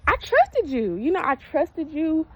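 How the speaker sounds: high, hurt plea. Real, not acted. Hurt outburst at stylist.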